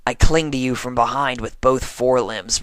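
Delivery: plain reading voice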